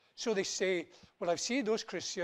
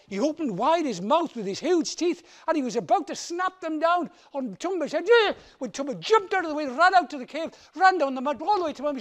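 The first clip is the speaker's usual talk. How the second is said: loud, fast, higher chase narration; breathless chase; 9 semitones higher, 12 dB louder than usual. A narrator's reading. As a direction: race through the escape. Escape from the lion.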